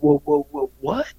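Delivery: high, alarmed whoa; startled whoa. Retold or imitated. Musician seeing the goal move behind his van, startled whoa. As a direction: react to the goal falling in the mirror. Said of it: "in my head, I'm just like".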